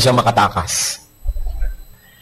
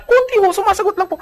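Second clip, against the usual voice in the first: mock sheepish answer. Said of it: "Eh, konti po".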